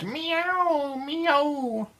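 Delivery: very high mewing cat; cat meow. Acted. Story cats hiss a warning at Harriet, high meow. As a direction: meow like a little kitten.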